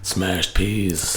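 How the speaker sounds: low, soft sultry croon. Pretend.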